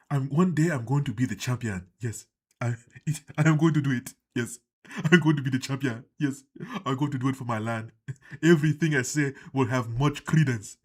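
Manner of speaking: hammed-up African accent, forceful; mock African accent, declaring it like a solemn vow. Would-be champion vowing for his land, mock African accent. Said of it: "you just have an African voice just randomly".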